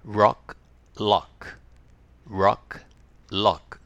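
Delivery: Rock with a rounded R, lock with a light L; R and L minimal pairs. Shown as a demonstration. Teacher drilling rock and lock with students. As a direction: alternate R and L cleanly. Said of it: "はい、一緒に。".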